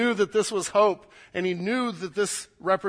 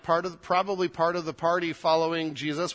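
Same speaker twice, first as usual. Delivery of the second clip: normal talking voice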